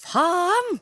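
East Norwegian swearword. Shown as a demonstration. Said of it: "så sier jeg".

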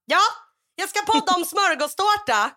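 high, loud gleeful declaration; hammed-up excited exclamation; mock eagerness. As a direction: imitate an over-eager would-be podcaster. Imitates over-eager friend.

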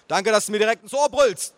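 yelling back. A narrator's reading. Nephew to aunt at his ear, yelling back. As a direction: yell back in sarcastic thanks. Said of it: "brüllte ich zurück".

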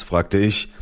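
plain reading voice

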